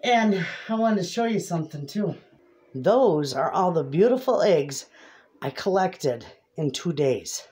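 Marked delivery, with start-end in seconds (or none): none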